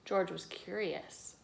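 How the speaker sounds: ordinary delivery, nothing marked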